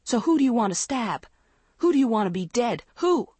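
fast, high, loud demands; furious interrogation. A narrator's reading. Andrea demands who her friend wants dead, furious. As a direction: fire off questions, climbing to a piercing last word. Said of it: "Andrea cried furiously".

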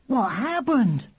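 high, slow cry of dismay; miserable. A narrator's reading. William finds his brother hurt in the road, miserable. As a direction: drag out the question, pitched high and upset. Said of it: "he said miserably".

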